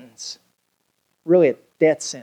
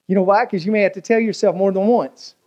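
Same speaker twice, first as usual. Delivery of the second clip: ordinary delivery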